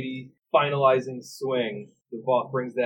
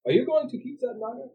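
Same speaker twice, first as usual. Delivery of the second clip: curious stranger; 12 semitones higher, faster than usual. Acted. NPC voiced.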